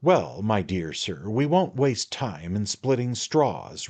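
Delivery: high, wheedling man-of-the-world patter; a hint of a knowing little lawyer; sly attorney, coaxing. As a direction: draw him in like a confidant. The attorney talks in the story.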